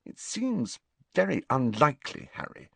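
prim dampening tone; repressive. A narrator's reading. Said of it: "in a repressive sort of voice".

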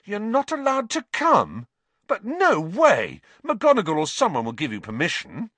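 loud, high-pitched disbelief; aghast, high and emphatic; horrified. A narrator's reading. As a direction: protest in horrified outrage. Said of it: "Ron looked horrified".

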